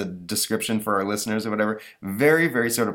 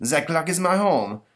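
put-on French accent; 9 semitones higher, 3 dB louder, slower than usual. Napoleon insists.

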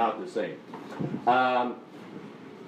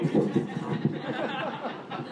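amused laugh; 12 semitones higher than usual. Real, not acted. Laughs after own joke.

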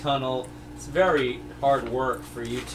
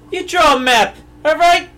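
high, loud exasperated yell; exasperated shout at the mapmaker; mock outrage; 12 semitones higher, 8 dB louder than usual. Real, not acted. Mock outrage at drawn map.

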